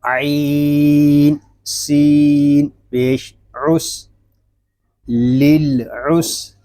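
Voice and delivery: slow, drawn-out letter-by-letter recitation; Quran spelling drill. Shown as a demonstration. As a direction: spell out each letter, stretching it slowly. (धीमे, खींचकर हिज्जे करते हुए)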